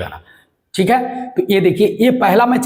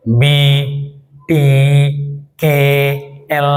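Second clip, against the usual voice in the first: consonants forced out with the nose pinched; nose held shut; 3 semitones lower, much slower than usual. Shown as a demonstration. Teacher proving consonants fail with nose closed. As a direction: try the letters with a blocked nose. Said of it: "नाक बंद करके आप कभी भी".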